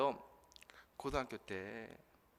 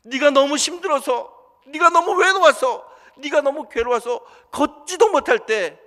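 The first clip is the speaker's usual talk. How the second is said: much louder, higher, slow, pleading; impassioned compassion; 9 semitones higher, 14 dB louder, slower than usual. Acted. Jesus tells the man why he carried him, loud slow impassioned. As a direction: pour out each phrase with aching love.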